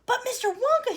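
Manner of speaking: high, loud call; shouted. A narrator's reading. Mike shouting at Mister Wonka. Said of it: "he shouted".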